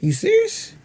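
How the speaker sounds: are you serious? jumps way up high and rises; high shocked question. Shown as a demonstration. Actor demoing the line differently, high squeal. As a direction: squeal it in disbelief. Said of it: "we'll say it different ways".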